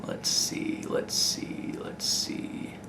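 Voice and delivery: whispered to himself while thinking; whispering. Real, not acted. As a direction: murmur it under your breath. Thinking aloud while searching.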